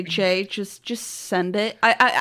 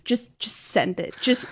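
mock sweet coaxing; 5 semitones lower, 6 dB quieter than usual. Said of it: "so I'm like".

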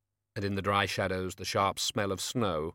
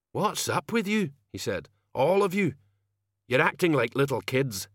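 lightly voiced thug, put out and louder at moments; annoyed gang member; 5 semitones higher, 3 dB louder than usual.

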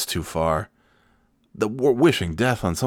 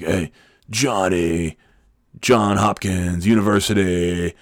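slow, drawn-out coworker impression; Adam; slower than usual. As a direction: stretch out each word like the intense guy. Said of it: "he sounded like Adam".

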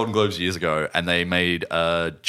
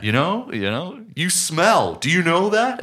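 higher, drawn-out mock scolding; imitated confrontation; 7 semitones higher, slower than usual. Imitating film character confronting homeless guy on the street, mock scolding. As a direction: taunt him in an exaggerated American movie voice. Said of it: "he confronts the homeless guy on the street".

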